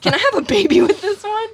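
high, loud laughing creepy Sim; creepy Sims person voice. Retold or imitated. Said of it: "it's like".

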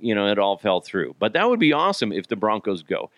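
ordinary talk

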